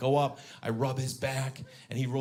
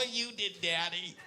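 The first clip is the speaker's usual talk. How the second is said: put-on high, slow, giggly child voice; excited little girl; 11 semitones higher, slower than usual. Daughter falling for dad's question, excited high giggly answer. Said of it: "She got all excited".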